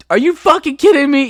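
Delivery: can't believe what he hears; outraged sergeant. Retold or imitated. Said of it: "He's like".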